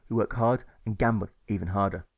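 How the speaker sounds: ordinary delivery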